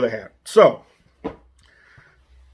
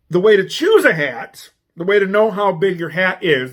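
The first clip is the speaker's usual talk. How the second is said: usual speaking voice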